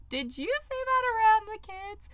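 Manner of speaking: high, surprised parent asking; parent's question. Retold or imitated. Nanny family asking if she said it. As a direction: play the puzzled parent checking in. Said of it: "my nanny family texted me because they're like".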